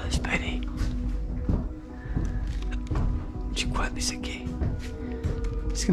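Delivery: hushed whisper. Real, not acted. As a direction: whisper it, close and low. Hushed while exploring old house.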